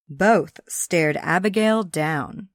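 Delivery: usual speaking voice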